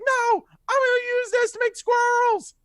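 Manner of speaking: put-on loud, very high squealing child voice; defiant kid. Kid threatens to make squirrels, high defiant squeal. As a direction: yell it like a bratty little kid. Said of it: "the kid's like".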